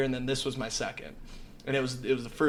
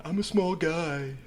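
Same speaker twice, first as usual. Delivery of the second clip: higher, slow mock whine; mocking drunk excuse; 4 semitones higher, slower than usual. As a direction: tease his lightweight excuse. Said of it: "and you're number one".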